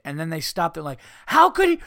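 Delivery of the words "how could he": shrill, much higher, loud fake gasp; mock outrage. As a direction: ham up scandalized shock. Mocking hosts' outrage.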